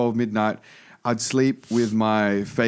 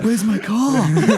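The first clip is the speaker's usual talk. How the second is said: high, drawn-out mock panicked wail; freaking out; 9 semitones higher, 4 dB louder, much slower than usual. Man acting frantic over his stolen car, freaking out. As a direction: play up a loud panic, stretching it out. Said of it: "freaking out".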